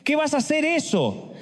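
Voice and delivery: high, scoffing incredulity; mocking disbelief. Real, not acted. Preacher mocks the congregation's excuse, high and scoffing. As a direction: sneer the question up high.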